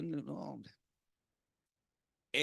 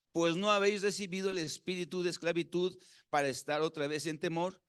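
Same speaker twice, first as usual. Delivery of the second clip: ordinary talk, nothing marked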